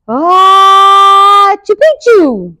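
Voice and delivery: huge, high, loud fake sneeze; half-sneezed word. A narrator's reading. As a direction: wind up on a long Ah, then sneeze the word out. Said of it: "El Scapito half sneezed, half spoke".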